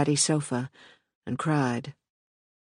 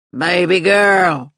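slow, loud slurred call; louder drunk call; 3 semitones higher, 8 dB louder, slower than usual. Acted. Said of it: "he said louder".

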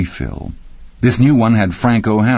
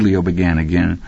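plain reading voice

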